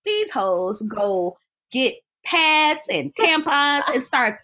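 ordinary delivery

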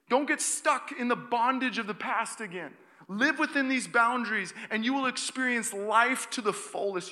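high, loud scripture proclamation; preaching. Acted. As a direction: proclaim the verse with force. Proclaims scripture at full force.

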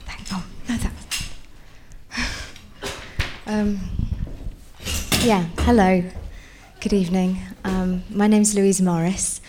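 stage fright. Real, not acted. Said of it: "I'm a bit nervous".

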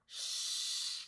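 soft hushing "shh"; whispered shush. A narrator's reading. Calvin, finger to her lips, whispered shush. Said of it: "he whispers".

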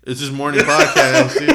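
loud, laughing protest; laughing excuse. Real, not acted. Host excusing fumbled words to co-host, laughing. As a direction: blame it on the hour while cracking up.

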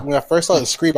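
ordinary talk, nothing marked

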